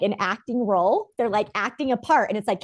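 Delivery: usual speaking voice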